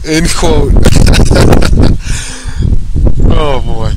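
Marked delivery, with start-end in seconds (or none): 0.8-3.3 loud breathy laughter; unvoiced laughing fit; real laugh. Real, not acted. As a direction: laugh it off in disbelief. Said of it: "laughs again after quote".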